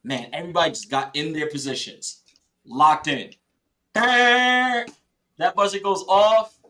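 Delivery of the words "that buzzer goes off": slow, drawn out and louder; hyped slow-motion delivery; dramatic buildup. Real, not acted. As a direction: stretch each word for drama. Climax of story.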